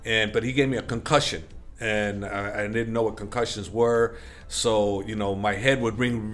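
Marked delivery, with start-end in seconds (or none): none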